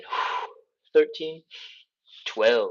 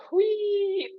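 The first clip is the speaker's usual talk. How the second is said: long, high-pitched "whee"; playful whee; 4 dB louder than usual. Pretend. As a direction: squeal a playful, drawn-out whee. Playful cry while folding down.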